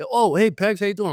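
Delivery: retold friendly greeting. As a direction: imitate a star casually greeting a rookie. DiMaggio greets awestruck rookie.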